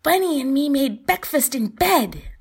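put-on high loud little boy voice; proud child. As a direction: announce it like a proud kid. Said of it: "pride beaming from him".

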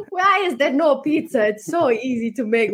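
an Italian man complaining to the chef, in a hint of an Italian accent; mild mock Italian accent. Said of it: "in his little Italian accent".

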